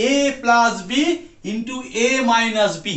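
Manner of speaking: slow, word-by-word formula; over enunciating. Shown as a demonstration. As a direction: spell out the formula slowly, like to a child. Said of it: "একদম বাচ্চাদের মতো করে বলছি". (ধীরে, প্রতিটি শব্দ স্পষ্ট করে)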